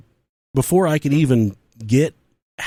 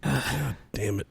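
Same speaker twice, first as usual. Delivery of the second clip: slow, much softer groan of a curse; under the breath; 11 dB quieter, slower than usual. Retold or imitated. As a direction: mutter it to yourself. Muttering to himself at the dude.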